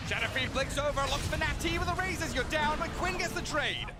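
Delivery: fast, high hype call of the fight; excited play-by-play. Acted. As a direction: ride the action like a live caster. Caster hyping a teamfight.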